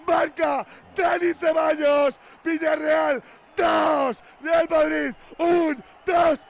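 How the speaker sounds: shouted goal call, building excitement; breakneck goal commentary. Real, not acted. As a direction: scream the goal call live.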